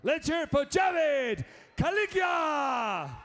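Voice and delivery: announcer shout. Acted. Announcer hypes fighter.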